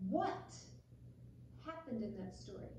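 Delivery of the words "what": plain reading voice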